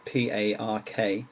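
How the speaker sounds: ordinary talk, nothing marked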